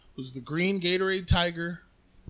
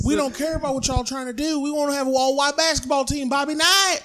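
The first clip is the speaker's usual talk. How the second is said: higher voice of a gruff Indiana coach; mock Indiana bigot; 8 semitones higher than usual. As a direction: play a proud Hoosier coach.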